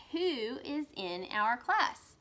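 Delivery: Who is in our class? slow with who rising; slow question demo. Shown as a demonstration. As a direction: read as a question. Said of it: "That's asking a question".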